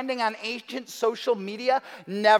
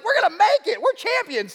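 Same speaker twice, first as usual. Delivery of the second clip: much higher, louder champion cheer; mock triumphant; 9 semitones higher, 4 dB louder than usual. A proud nation boasting of its strength, mock victorious. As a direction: crow it like a winning team. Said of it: "the country went from being like".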